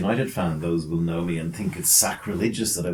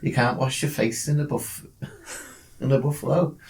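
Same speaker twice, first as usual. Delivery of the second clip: put-on Birmingham accent; 6 semitones higher than usual. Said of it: "has to be done in a Birmingham accent".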